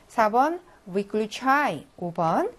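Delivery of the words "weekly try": "weekly try" very slow, final "chai" stressed and long; model reading. Shown as a demonstration. Teacher rereads for students, slow "weekly try" stressed at the end. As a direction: draw out the last syllable of "weekly try". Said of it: "다시 한번 읽어볼게요".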